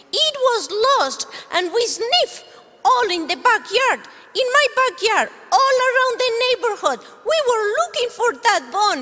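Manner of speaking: high, bright little dog voice; puppy puppet. Acted. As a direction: play the eager puppy.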